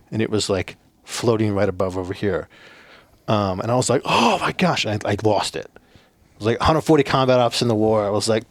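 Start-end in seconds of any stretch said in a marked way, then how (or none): 4.0-4.8 retold "oh my gosh", pitched much higher; shocked gasp. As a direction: jump up in fright at the memory. Retelling the demon appearing.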